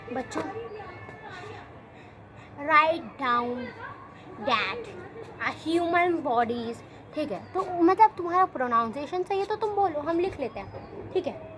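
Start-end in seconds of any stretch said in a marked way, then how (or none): 0.1-6.8 imitating the teacher. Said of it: "वो ऐसे बोल देगा".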